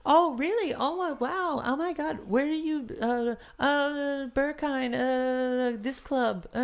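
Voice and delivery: very high, mocking gushy small talk; boring cliche conversation. Mocking two travellers' club small talk, hammed-up high voice. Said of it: "the most boring cliche conversation".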